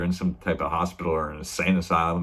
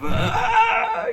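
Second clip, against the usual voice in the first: shrieking high cry of pain, much louder; imitated pain scream; 5 dB louder than usual. Finger chopped off at the poker table, piercing pain scream. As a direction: let out a sudden piercing yelp of pain. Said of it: "you could just feel the pain".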